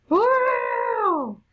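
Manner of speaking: very high, long "woo" squeal; excited whoop. Real, not acted. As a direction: let out a shrieked cheer. Celebrates in the game.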